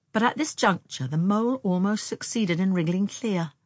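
plain reading voice

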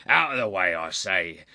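insistent bruiser's order; crying out. A narrator's reading. Prize fighter repeating his order to the lady, crying out. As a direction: insist that she move aside. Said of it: "he cried".

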